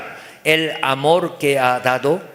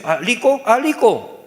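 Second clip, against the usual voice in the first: put-on raised-pitch child echoing "lico"; son repeating; 6 semitones higher than usual. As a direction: obediently copy dad's L in "rico". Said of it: "y dice".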